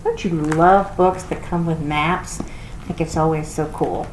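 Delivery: ordinary delivery, nothing marked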